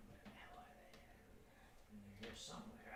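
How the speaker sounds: hushed whisper while searching. Real, not acted. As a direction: breathe it under your breath as you hunt. Searching muttering to himself.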